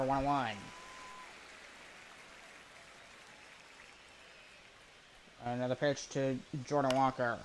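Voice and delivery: brisk announcer call of the play. Acted. Streamer calling his own video game, play-by-play voice.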